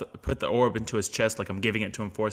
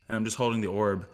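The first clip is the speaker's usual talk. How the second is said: ordinary talk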